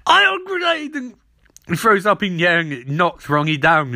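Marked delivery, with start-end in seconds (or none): 0.1-0.9 villain shout. Retold or imitated. Eiling throwing a grenade at the heroes, shouting high and gleeful. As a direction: announce the grenade like a cackling baddie. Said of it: "Idling's like".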